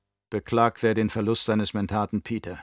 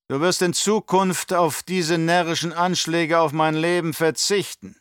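put-on higher, louder, oily old Baron voice; scheming old Baron; 7 semitones higher, 4 dB louder than usual. Baron to his nephew, raised and sly. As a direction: play the cunning uncle sounding out his nephew.

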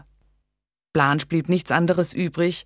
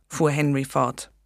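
ordinary delivery

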